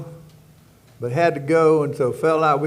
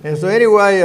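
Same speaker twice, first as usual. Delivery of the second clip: laughing, louder and higher; 6 semitones higher, 8 dB louder than usual. Real, not acted. Laughing at his own joke, he gets back to the story. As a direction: push on through the laugh.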